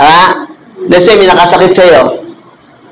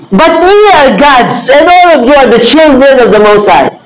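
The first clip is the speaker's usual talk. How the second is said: preaching proclamation; 6 semitones higher than usual. Acted. Pastor proclaiming psalm verse.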